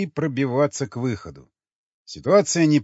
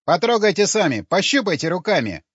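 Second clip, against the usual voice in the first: higher, insistent sales pitch; pushy pimp urging; 6 semitones higher, 4 dB louder than usual. A narrator's reading. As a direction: urge the customer on in a raised voice. (настойчиво зазывать, высоким голосом)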